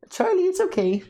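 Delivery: high cooing pet voice; dog talk. Real, not acted. Owner calming her crying dog Charlie, high cooing pet voice. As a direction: soothe the dog in a sing-song high voice.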